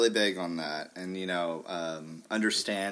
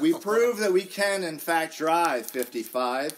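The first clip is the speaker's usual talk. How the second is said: higher, louder promo read; dramatic promo; 6 semitones higher, 5 dB louder than usual. Acted. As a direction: hype it up, then slow down. Host's dramatic promo line.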